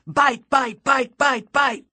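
loud, high rapid-fire chant; said loudly. A narrator's reading. Players tagging their first vamp, called loudly and high. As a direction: call each word out high and hard. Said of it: "we both said loudly".

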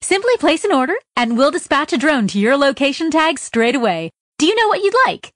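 high, bright ad recording; chipper recorded voice. Acted. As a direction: sell it like a perky phone menu. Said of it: "a chipper recording exclaimed".

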